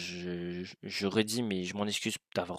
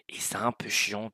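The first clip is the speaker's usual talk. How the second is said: whispering. Real, not acted. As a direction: whisper so nobody hears. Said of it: "désolé si je chuchote un peu".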